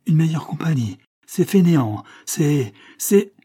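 angry retort breaking off; choking anger. A narrator's reading. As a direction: sputter in outrage until words fail. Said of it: "la colère le serrait à la gorge".

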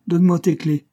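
loud, high policeman's command; officer giving an order. A narrator's reading. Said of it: "ordonna l'agent".

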